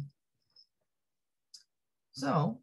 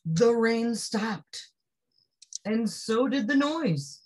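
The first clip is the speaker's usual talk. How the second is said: slow, higher announcement; slow reveal; 6 semitones higher, much slower than usual. A narrator's reading. Narrator announcing the end of the storm, slow and higher. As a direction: let the calm arrive slowly. Storybook turning point.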